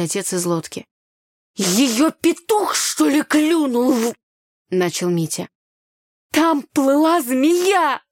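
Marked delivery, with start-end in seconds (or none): 1.6-4.1 higher, loud, drawn-out indignation; irritated complaint. A narrator's reading. Brother complaining to father about sister, exasperated. 6.3-8.0 high, loud, very drawn-out cry; alarmed exclamation. A narrator's reading. Girl defending her panic over a snake, exclaiming. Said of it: "воскликнула я".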